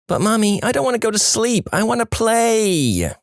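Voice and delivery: mock child pleading to stay up; whiny little kid. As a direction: beg mommy like a sleepy kid. Squeaker kid whining to mom.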